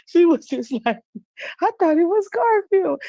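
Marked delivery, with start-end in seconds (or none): 1.6-3.0 high-pitched mock innocence; imitating her innocent little explanation; innocent niece, imitated. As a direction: play the clueless niece, voice pitched way up. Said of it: "she was so innocent with it".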